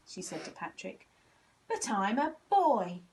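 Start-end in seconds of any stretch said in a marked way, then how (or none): 1.7-3.0 loud and slow, stubborn protest; a boy penguin loudly insisting to the doctor; shouting. A narrator's reading. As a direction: shout it out, offended. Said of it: "he shouted".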